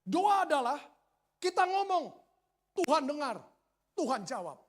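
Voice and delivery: imitating a rousing teacher, loud and high; teacher's proclamation. Bible school teacher defining prayer to students, imitated proclamation. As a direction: declaim it in short bursts, easing at the end.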